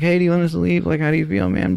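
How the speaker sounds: high, careful polite question; retold concerned check-in. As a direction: imitate a tactful check on a sick host. Checking on sick host.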